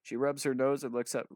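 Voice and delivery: usual speaking voice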